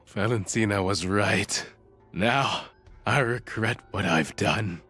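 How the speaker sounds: remorseful. Acted. Kidnapper admits regret.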